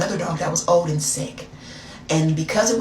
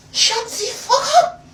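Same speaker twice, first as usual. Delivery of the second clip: put-on high-pitched French-accented shriek; indignant French woman, pitched high; 4 dB louder than usual. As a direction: snap it in a mock French accent. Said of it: "Girl, I had that accent".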